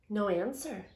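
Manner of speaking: usual speaking voice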